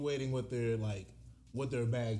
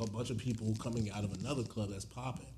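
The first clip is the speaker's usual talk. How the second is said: ordinary talk, nothing marked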